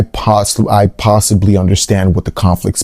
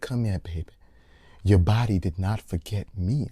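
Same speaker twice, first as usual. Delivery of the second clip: much softer, lower, hushed tender line; hushed quote; 4 semitones lower, 15 dB quieter than usual. Retold or imitated. Retelling the character's tender words to her lover, hushed. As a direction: breathe it like a soft lover's line.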